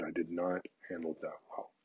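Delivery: unvoiced, hushed confession; whispered apology. Apologizing to the woman he snarled at, in a whisper. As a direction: breathe it as a gentle apology. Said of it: "I said".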